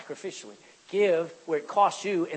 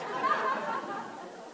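high-pitched laugh at his own joke; laugh, high and breathy; 6 dB quieter than usual. Real, not acted. Preacher to his congregation after his punchline, high real laugh.

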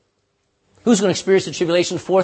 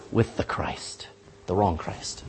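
whisper; 10 semitones lower, 9 dB quieter, slower than usual. Real, not acted. Ominous warning about the Antichrist.

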